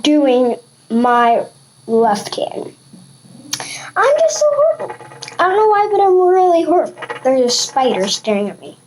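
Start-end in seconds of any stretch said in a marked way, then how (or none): 4.0-7.0 whiny. Real, not acted. Frustrated at left hand.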